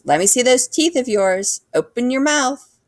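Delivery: a lightly voiced dentist giving orders; stern dentist. A narrator's reading. Dentist telling the boy to open his mouth, bossy.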